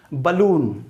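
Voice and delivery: बलून with a reduced first vowel, "buh-LOON"; correct pronunciation. Shown as a demonstration. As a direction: model बलून as buh-loon, falling at the end. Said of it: "इसका pronunciation क्या होता है".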